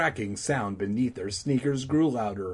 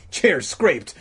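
ordinary delivery

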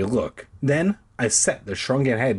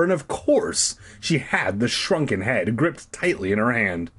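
ordinary delivery

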